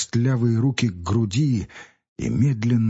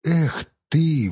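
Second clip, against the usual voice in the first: exclaiming; 6 semitones higher than usual. A narrator's reading. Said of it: "воскликнул Федя".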